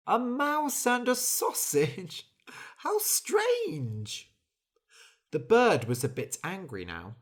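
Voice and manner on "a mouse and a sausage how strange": high, slow amazed laugh through the words; amused disbelief. Acted. Bird laughing at the mouse and sausage household. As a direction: laugh at how odd it sounds.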